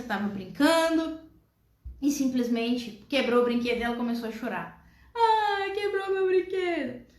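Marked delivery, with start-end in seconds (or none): 5.2-7.1 put-on high, slow whiny child's cry; mock child crying. Child cries to mother about broken toy, whiny wail. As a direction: act out a small child wailing over her toy.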